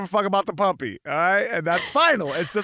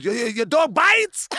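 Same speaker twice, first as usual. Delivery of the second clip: raised, louder street call; mock neighbor hollering over; imitated yell; 5 semitones higher, 5 dB louder than usual. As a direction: yell the question at a dog walker. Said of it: "He yells at me".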